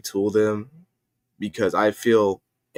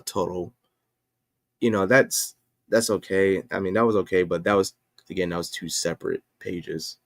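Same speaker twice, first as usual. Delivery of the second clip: ordinary talk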